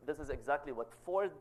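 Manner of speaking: ordinary talk, nothing marked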